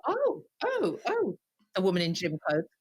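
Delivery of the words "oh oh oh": high, falling repeated "oh"; onlookers' surprised oohs. Retold or imitated. Everybody noticing the woman in gym clothes, imitated surprise. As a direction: imitate a murmuring crowd, much higher than usual. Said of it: "everybody's like".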